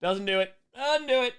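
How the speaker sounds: failed high puppet voice; trying out a high, loud puppet voice; Elmo. Acted. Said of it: "my voice is too cracky".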